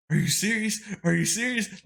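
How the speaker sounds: high, loud mock disbelief; imitating overwhelmed excitement. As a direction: act out a daughter floored by a surprise. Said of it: "she started hyperventilating".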